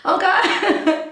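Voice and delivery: loud, high laughing reply; laughing disbelief. Retold or imitated. Woman retelling her reaction to being called a snob, laughing disbelief. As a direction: burst out with it through laughter.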